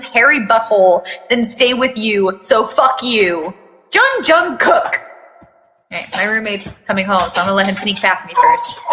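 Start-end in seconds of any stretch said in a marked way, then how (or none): 3.9-5.0 high, drawn-out call of the full name; calling out his name. Acted. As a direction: summon the kid like an angry mom. Mom calling out son's name.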